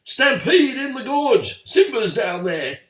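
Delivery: faked panic shouting for help; alarmed shout. A narrator's reading. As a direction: scream the warning across the gorge. Scar raising the alarm.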